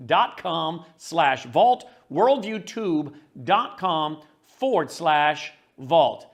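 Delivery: drawn out, every part spaced; slow careful dictation; slow, deliberate web address. Shown as a demonstration. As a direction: spell out the URL slowly for listeners. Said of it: "Please check it out".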